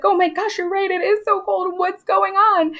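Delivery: high-pitched imitation of a panicky girl; mock-alarmed girl. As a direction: play an alarmed girl joining in. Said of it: "So Mercy Lewis".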